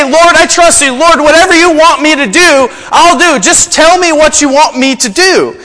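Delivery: hammed-up promise to God; mock eager pious voice. Believers pretending full trust in the Lord, hammed-up. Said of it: "We say".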